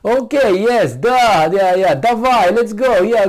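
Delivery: loud, gushing yes-man with Russian words; loud happy Gorbachev caving in; eager Gorbachev. Retold or imitated. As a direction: play a loud, eager pushover agreeing to everything. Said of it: "And Gorbachev said".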